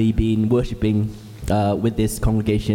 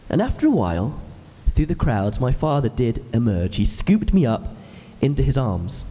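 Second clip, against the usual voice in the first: ordinary talk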